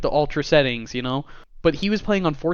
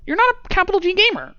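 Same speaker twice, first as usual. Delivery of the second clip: snooty; 4 dB louder, faster than usual. Pretend. Mocking the executive's snobbery.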